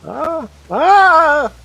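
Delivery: high eerie ghostly wail. Pretend. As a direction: moan like a spooky ghost. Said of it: "You sound like a ghost".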